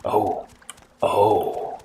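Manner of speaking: slow, hushed whisper of a man being tempted; whispered inner voice. Retold or imitated. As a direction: whisper the thoughts of a miner talked into evil.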